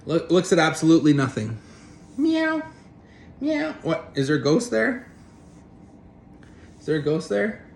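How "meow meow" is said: high mock cat meows; imitated meows. A cat staring at nothing on the wall, mewing high.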